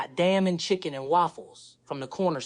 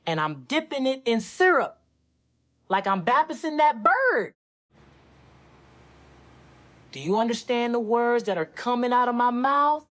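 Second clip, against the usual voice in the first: higher, excited Chris Tucker rant; Chris Tucker impression; 6 semitones higher than usual. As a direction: push it up high like Chris Tucker. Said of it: "do your Chris Tucker impression".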